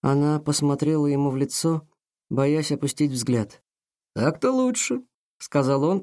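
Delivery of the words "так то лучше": smug smiling. A narrator's reading. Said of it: "продолжая улыбаться".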